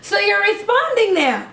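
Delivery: high, laughing surprise; be thrilled your toddler finally answered; delighted surprise. Retold or imitated. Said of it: "I was like".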